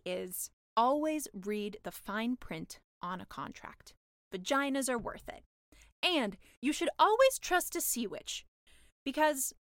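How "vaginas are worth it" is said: plain reading voice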